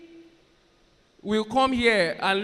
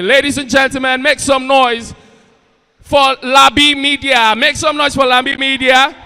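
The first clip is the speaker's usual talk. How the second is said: louder MC call to the crowd; host calling the audience to cheer; hype announcer; 3 semitones higher, 7 dB louder than usual. Real, not acted. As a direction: build up the crowd for the guest. MC introduces a guest.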